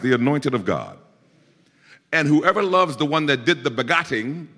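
high, ringing pulpit exhortation; fervent preacher's appeal; preaching at full force. Real, not acted. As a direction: drive it home to the congregation.

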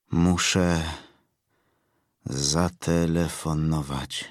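low, quiet, slow and drained of life; lifeless voice. A narrator's reading. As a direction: say it hollow, as if nothing is left inside. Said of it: "głosem ... pozbawionym życia".

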